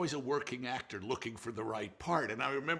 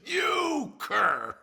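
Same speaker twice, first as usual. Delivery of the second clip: high, loud hammed-up insult; Ollie's shout; 11 semitones higher, 10 dB louder than usual. Ollie berating Stanley, loud mock-outraged bellow. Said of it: "He says".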